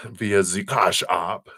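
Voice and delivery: slow, lightly put-on German or Swedish accent; drawn-out mock German or Swedish lilt. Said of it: "I go into German or Swedish".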